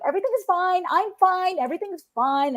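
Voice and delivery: high, insistent mock reassurance; pretending all is fine. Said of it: "silence this part of yourself and act like".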